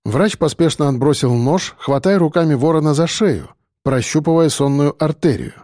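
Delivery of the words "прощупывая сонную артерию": usual speaking voice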